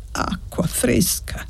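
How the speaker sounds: put-on voiceless, slow, faint old man; weak rasp. A narrator's reading. Sick old man begs for water, faint and breathless. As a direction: barely breathe the words out.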